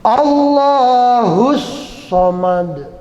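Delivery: "Allahus" drawn out very slowly with an emphatic s; emphatic sod. Shown as a demonstration. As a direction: stretch "Allahus" slowly, hissing a heavy s. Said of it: "As-Samad itu sod".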